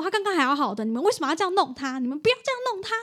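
the owner protesting, fast and heated; imitating angry owner. Owner begging the vet to stop, in a retold angry cry. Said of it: "然后就很生气".

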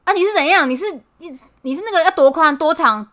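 loud, high mock yell at the customer; hammed-up furious shopkeeper; imitating an angry shopkeeper. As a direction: bark it like a grumpy old shop owner. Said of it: "他就说".

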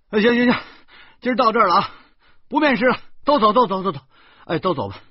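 high, loud, urgent dismissal; exasperated shooing. A narrator's reading. Employer blocking the door to candidates, loudly fed up.

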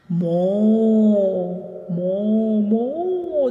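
playful mock cow lowing; mooing like a cow. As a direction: moo like a cow, lost in the memory. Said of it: "就开始在学牛叫".

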